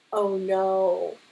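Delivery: ordinary delivery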